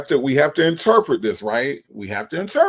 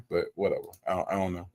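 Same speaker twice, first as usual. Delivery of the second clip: ordinary talk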